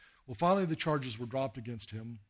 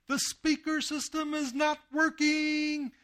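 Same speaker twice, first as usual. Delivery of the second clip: high, slow, sing-song mock Mass chant; mock priest intoning; 5 dB louder, much slower than usual. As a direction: lampoon a priest droning the liturgy, high and drawn out. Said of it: "he yells to the people".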